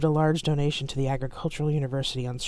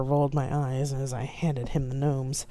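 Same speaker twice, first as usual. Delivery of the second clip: ordinary delivery, nothing marked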